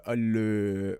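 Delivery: drawn-out French filler, imitated; deliberate French stutter. Stalling on purpose to seem trying, imitated French hesitation. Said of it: "I would purposely try to say".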